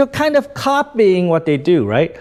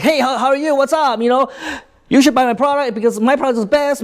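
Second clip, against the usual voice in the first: rapid-fire, high-pitched sales patter; really fast talker; 8 semitones higher, faster than usual. Shown as a demonstration. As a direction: rush the pitch out, high and breathless-quick. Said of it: "if I talk really fast".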